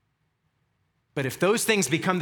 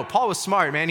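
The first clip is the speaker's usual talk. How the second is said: usual speaking voice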